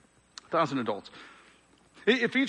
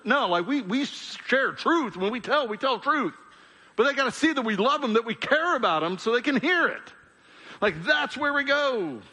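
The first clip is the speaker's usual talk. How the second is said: high-pitched, emphatic sermon delivery; impassioned preaching; 9 semitones higher than usual. Real, not acted. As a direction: drive the truth home, pitched high. Preaches with raised intensity.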